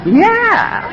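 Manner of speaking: high, drawn-out yes; eager giving in. Acted. Earl behind the beast agreeing, high and eager. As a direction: stretch it out high, completely won over.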